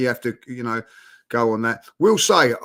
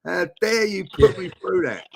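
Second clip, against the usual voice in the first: higher, imitating an angry rant at the team; mock angry outburst; 6 semitones higher, 3 dB louder than usual. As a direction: replay the fury at his own side, half-joking. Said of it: "and I, I was angry".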